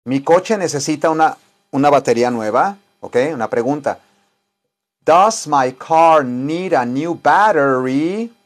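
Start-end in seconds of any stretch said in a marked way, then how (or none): 5.1-8.3 very slow, rising question; each word clear, "Does" leading; slow model sentence. Shown as a demonstration. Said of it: "Repeat after me". (pregunta modelo muy lenta)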